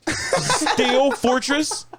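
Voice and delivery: drawn-out high laughing question; incredulous. Real, not acted. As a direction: stretch the name in disbelief. Incredulous repeat.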